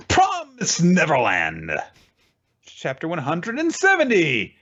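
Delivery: drawn-out, high title announcement; mock announcer. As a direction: announce it grandly, stretching the title. Announces the next manga title.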